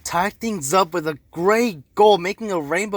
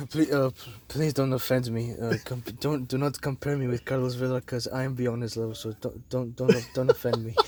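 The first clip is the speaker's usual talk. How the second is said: lower, much softer arrogant star voice, lightly done; low, hushed mock swagger, breaking into a laugh at the end; imitating Zlatan; 5 semitones lower, 7 dB quieter than usual. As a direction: quote the proud striker with quiet mock disdain. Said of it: "I'm quoting Zlatan".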